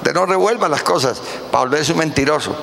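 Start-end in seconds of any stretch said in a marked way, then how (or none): 0.0-1.2 voz de predicador más aguda y alzada, con fuerza; proclámalo desde el púlpito, más agudo; predicación enfervorizada. Real, not acted. (predicador que exhorta a la congregación con voz aguda y fuerte)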